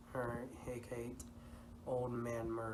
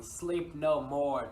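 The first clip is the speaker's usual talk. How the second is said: loud, slow, higher cry of Sleep no more; 4 semitones higher, 10 dB louder, slower than usual. Retold or imitated. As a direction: cry it out like a haunting voice. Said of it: "The voice kept crying".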